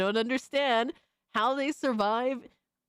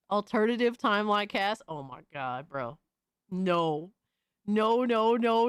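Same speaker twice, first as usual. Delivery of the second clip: normal talking voice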